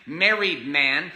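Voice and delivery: usual speaking voice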